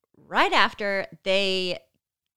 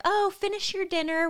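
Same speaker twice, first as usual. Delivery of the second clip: breezy reassurance; 12 semitones higher than usual. Retold or imitated. Staff casually reassuring absent doctor.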